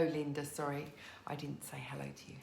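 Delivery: usual speaking voice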